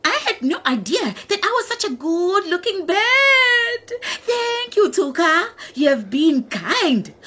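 high, grateful bird voice; the ostrich thanks Tuka in a high, happy voice. Acted. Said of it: "cried the ostrich happily".

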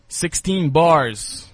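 drawn-out, loud hype call; slow MC hype; slow hyped announcement. Acted. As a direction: stretch it out like an MC intro. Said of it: "hit you with some bars".

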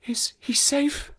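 slow, high, whispery question; weak whisper. Acted. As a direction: ask faintly, just back from a faint. Fainted woman reviving asks anxiously.

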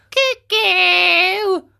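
high, loud drawn-out cuckoo call; mocking. A narrator's reading. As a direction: taunt them like a cuckoo bird. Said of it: "mocking them".

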